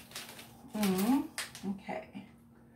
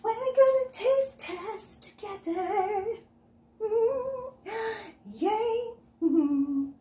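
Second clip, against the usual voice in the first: gushing squeal; 12 semitones higher, much slower than usual. Real, not acted. Excited about the taste test.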